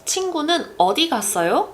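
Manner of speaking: 어디 lifted high and stressed. Shown as a demonstration. Asking where your friend went, stress on 어디. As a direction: put the weight on 어디, a "where" question. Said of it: "I'm placing the emphasis on 어디".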